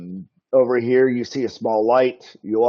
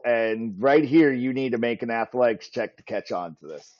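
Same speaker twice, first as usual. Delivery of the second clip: usual speaking voice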